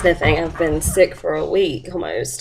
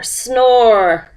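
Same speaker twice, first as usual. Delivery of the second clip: long drawn-out, louder "snore"; snoring bear; 4 semitones higher, 4 dB louder than usual. A narrator's reading. Reader voices Missus Bear asleep to the class, snoring. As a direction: stretch the word into a mock snore. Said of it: "Missus Bear began to snore".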